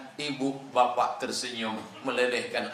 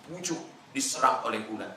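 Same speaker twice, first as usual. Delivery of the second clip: usual speaking voice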